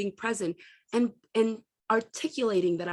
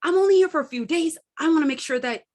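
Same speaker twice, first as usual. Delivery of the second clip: hurried defensive mom; 7 semitones higher, 5 dB louder, faster than usual. Retold or imitated. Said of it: "My initial instinct was to go".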